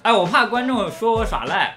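ordinary talk

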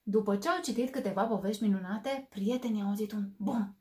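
put-on lively, animated read-aloud for kids; expressive bedtime story narration; storybook reading. A narrator's reading. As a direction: bring the story alive for little listeners. Reads picture book to children.

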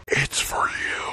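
whisper. Real, not acted. Handing over the phone quietly.

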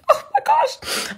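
very high, drawn-out squeal; delighted high-pitched gush; excited squeal. Real, not acted. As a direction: squeal it with a giddy laugh. Gushing over Christmas movies.

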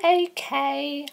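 put-on high-pitched girly falsetto. Contestant [name] answering the host, girly voice. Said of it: "why did you do that girly voice".

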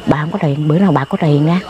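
soft, low, placating voice; gentle. Retold or imitated. Woman gently putting off an addict asking for money, gentle. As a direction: answer him softly and low, as a kindly granny. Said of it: "Nó hỏi nhỏ nhẹ dễ thương bộ".

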